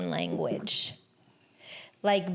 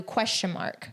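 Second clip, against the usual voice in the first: normal talking voice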